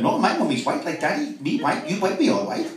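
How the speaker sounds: singsong mock Pakistani English accent. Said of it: "why are you talking like that".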